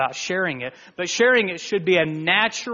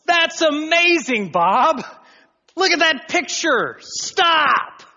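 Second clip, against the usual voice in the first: loud, much higher cry of amazement; excited shout; 12 semitones higher, 6 dB louder, slower than usual. Retold or imitated. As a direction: yell at the painter to stop. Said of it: "you go".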